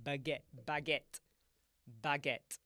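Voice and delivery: BAGUETTE as "bag-ETT" with a popped t; copied hard t. Shown as a demonstration. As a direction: hit the final t of BAGUETTE sharply. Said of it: "he did a t".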